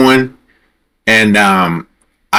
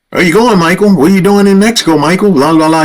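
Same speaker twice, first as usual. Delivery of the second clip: higher-pitched mock-officer sarcasm; sarcastic; 9 semitones higher than usual. Border officer questioning a driver, sarcastic. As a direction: imitate a smart-mouthed questioner with attitude. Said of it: "with a sarcastic attitude".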